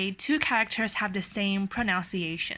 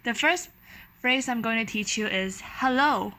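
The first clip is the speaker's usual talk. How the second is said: ordinary talk, nothing marked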